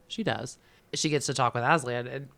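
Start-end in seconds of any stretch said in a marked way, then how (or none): none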